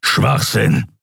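leicht grollende Figurenstimme; tu es mürrisch grollend ab. A narrator's reading. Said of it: "grollte Keru". (grollend abgetan)

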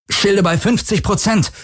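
high, strained call over the din; knirschend über Lärm. A narrator's reading. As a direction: grind it out over the noise. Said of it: "knirschte er über den Lärm". (angespannt knirschend über den Lärm gerufen)

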